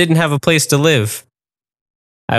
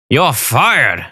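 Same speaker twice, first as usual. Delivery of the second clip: chef firing. A narrator's reading. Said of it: "the chef said".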